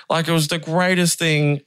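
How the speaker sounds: ordinary talk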